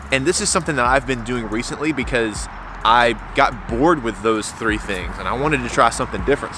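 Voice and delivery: ordinary delivery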